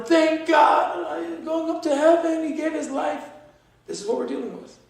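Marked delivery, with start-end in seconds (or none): 0.0-2.4 high loud mock cheer; mock celebrating shout. 2.4-3.2 high shouted mock cheer of a grieving mother; mock mother celebrating. Mocking Palestinian mothers celebrating martyrs.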